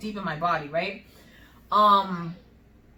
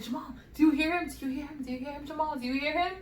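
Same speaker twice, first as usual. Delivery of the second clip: high, softer repeated questions; reenacted worried urgency; retold nervous questioning; 6 semitones higher, 3 dB quieter, faster than usual. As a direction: ask anxiously, higher each time than usual. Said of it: "so, like, scared and nervous".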